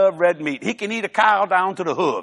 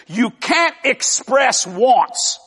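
very slow, each word drawn out; slow, deliberate; 2 semitones higher, much slower than usual. Shown as a demonstration. Preacher making sure listeners understand, slow and deliberate. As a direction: spell the point out slowly and firmly. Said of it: "I'm gonna say it slow".

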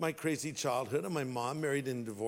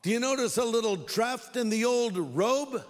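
raised, higher voice calling across a distance; yelling out; 6 semitones higher, 3 dB louder than usual. Retold or imitated. David calls to King Saul from a safe distance, yelling out. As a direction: call out as if to someone far off. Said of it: "he yells out".

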